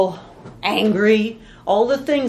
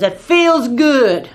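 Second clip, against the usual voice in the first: louder and very slow, savouring the anger; drawn-out, louder mock-angry relish; 3 semitones higher, 7 dB louder, much slower than usual. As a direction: lean into it like a man gloating over his grudge. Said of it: "That guy was mad, boy".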